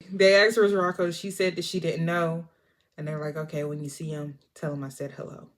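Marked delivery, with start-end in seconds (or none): 3.4-5.5 veiled threat. Retold or imitated. Gangsters leave veiled threat.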